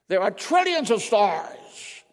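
loud, slow, high preaching; slow, ringing wonder at creation; preaching emphasis. Real, not acted. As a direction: stretch it out in awe. Preaching building awe.

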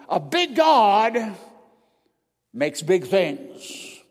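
slow, loud, every word weighted; preacher's slow, loud proclamation; slow emphatic. Real, not acted. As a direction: drive it home slowly. Preaching climax.

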